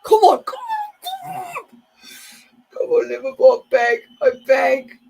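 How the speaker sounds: high squealed, loud begging; desperate pleading. Real, not acted. Fan begging Liverpool to come on, desperate pleading. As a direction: plead loudly with your team, voice shooting up.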